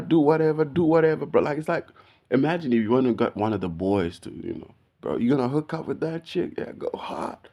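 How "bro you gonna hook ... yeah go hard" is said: casual hype for a mate, trailing off quieter on "go hard"; hyping up a buddy. Retold or imitated. Quoting how he hyped up one of the boys, easy hype. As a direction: egg on a friend, easygoing. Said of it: "That's how I treated my".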